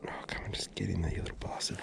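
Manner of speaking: frustrated whisper under the breath. Real, not acted. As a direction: hiss at the fiddly knot. Muttering at a knot.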